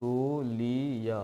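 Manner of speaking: Tetulia stretched out very slowly, syllable by syllable; slow, careful dictation of Tetulia. Shown as a demonstration. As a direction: spell out Tetulia slowly so students can write it. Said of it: "लिख लीजिएगा इसको सही से".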